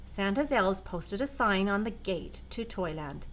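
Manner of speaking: ordinary delivery, nothing marked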